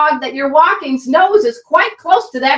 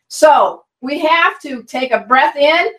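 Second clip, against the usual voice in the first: usual speaking voice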